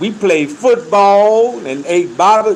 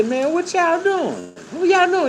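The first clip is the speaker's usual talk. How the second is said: puzzled new guy; 11 semitones higher, 4 dB quieter than usual. Retold or imitated. New inmate asks the others what they are doing, confused. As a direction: sound lost and a bit amused.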